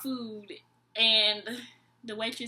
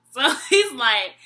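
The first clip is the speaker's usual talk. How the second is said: normal talking voice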